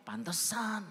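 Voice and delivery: high and loud, struck by it; sudden realisation. Real, not acted. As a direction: realise it all at once, aloud. (tersadar tiba-tiba, suara tinggi dan keras)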